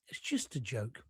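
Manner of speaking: fading almost to nothing; faint dismissive murmur. Real, not acted. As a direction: repeat it, trailing right off.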